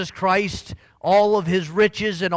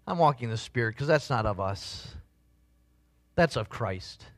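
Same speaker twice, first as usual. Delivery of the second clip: usual speaking voice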